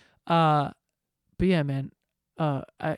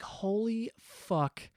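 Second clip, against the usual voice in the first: stunned; 5 semitones higher, 8 dB quieter, much slower than usual. Retold or imitated. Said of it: "And I was like".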